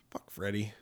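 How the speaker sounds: hushed unvoiced curse; whispered. Real, not acted. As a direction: whisper it under your breath. Muttered curse after pause.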